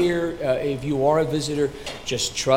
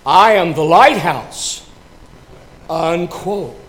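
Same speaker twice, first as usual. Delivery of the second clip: louder, slow, weighty announcement; lighthouse reply; 3 semitones higher, 7 dB louder, slower than usual. Acted. Lighthouse answering the captain, slow and loud. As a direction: land the punchline slowly. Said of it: "comes the reply".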